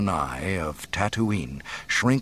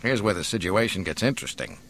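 plain reading voice

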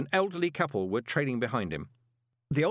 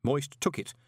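usual speaking voice

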